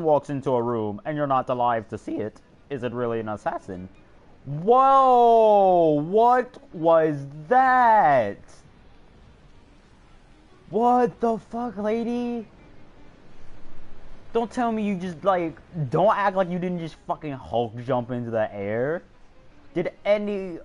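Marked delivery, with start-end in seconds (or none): 4.5-16.4 shocked outburst. Real, not acted. NPC suddenly leaps into air.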